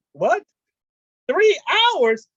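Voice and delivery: high, loud incredulous burst; can't believe the number; shocked disbelief. Retold or imitated. Hearing the sister talked to mom for hours, high loud disbelief.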